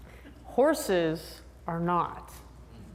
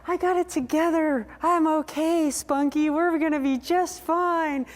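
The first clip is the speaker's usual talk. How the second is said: high, bright put-on bravado; high chirpy mock reassurance; fake confidence; 8 semitones higher than usual. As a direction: pretend everything's fine. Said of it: "you're like".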